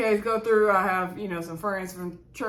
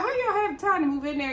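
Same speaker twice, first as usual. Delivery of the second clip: incredulous; 9 semitones higher than usual. Real, not acted. Vlogger's own reaction.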